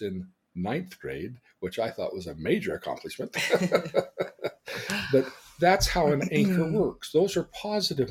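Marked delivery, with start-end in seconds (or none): 3.4-5.1 higher-pitched chuckling; real laughter. Real, not acted. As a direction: laugh at your own joke. Laughs at own joke.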